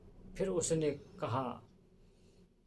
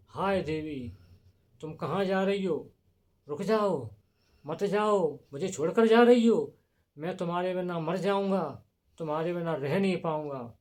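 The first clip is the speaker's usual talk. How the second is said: acted anguished plea, swelling louder; desperate pleading; 3 semitones higher, 6 dB louder than usual. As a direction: call after a departing lover, voice rising. Abandoned king pleads, running after Urvashi.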